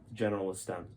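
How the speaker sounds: ordinary delivery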